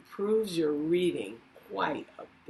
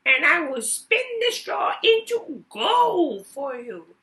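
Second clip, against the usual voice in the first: very high, loud goblin voice, slow on the ring; a pushy little goblin naming his price; sly goblin; 14 semitones higher, 7 dB louder than usual. Acted. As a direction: goblin bargaining for her ring. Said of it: "he said".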